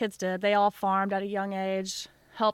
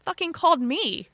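higher-pitched exasperated retort, imitating mom; mock outrage at being called; indignant mom; 8 semitones higher, 3 dB louder than usual. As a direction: play an affronted mom. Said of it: "My mom's like".

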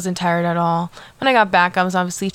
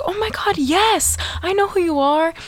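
much higher, squealing recognition; excited gushing; 10 semitones higher than usual. Retold or imitated. Singer recognizing a fan after her concert, mock excited squeal. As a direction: gush like you just spotted a friend.

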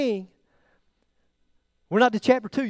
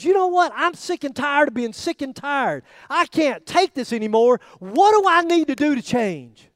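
loud, high, slow plea of a man at his limit; preacher voicing a desperate sinner, loud and high; desperate cry; 8 semitones higher, 6 dB louder than usual. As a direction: cry it out like someone fed up with himself. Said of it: "a person who says".

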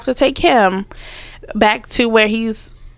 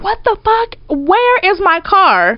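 outraged demand; 10 semitones higher than usual. Retold or imitated. Car owner demanding car from runaway friend, outraged. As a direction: stretch it out in high-pitched disbelief.

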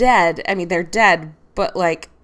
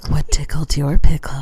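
whisper; 8 semitones lower, 7 dB louder than usual. Acted. Host repeats catchphrase, whispered.

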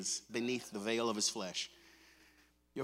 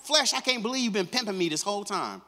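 said with a laugh and more push; louder, laughing rebuke to the flesh; laughing, louder line; 6 semitones higher, 5 dB louder than usual. Shown as a demonstration. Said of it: "go ahead, say it".

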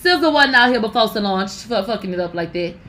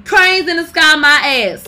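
usual speaking voice

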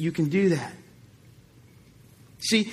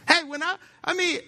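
usual speaking voice

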